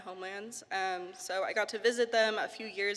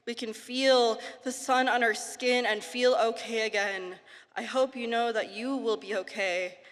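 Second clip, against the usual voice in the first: poem; 4 dB louder than usual. Acted. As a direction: read it like spoken-word poetry. Poetry reading.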